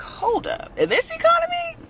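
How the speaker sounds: theatrical in this economy gasp; mock disbelief. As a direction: pitch shoots way up on the question, a hammed-up outraged squeak. Mock disbelief at cheap rent.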